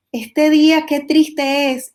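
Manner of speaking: slow, mildly gloomy line; a touch of rainy-day sadness, drawn out; mock gloomy sigh. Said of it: "alguna persona puede decir".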